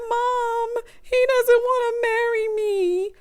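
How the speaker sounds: high, tearful wail to mom; imitating her crying. Marissa calling her mom after the breakup, high and crying. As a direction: cry it out like a heartbroken daughter. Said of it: "she said".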